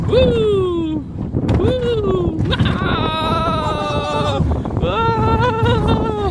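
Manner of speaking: high thrilled screams; on a roller coaster. Real, not acted. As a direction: scream through the bumps.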